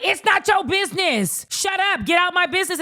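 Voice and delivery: high, mock-defensive retort; hammed-up, high-pitched protest; indignant telling-off. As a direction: imitate a touchy husband shutting someone out. Voices Adnan telling Shay off.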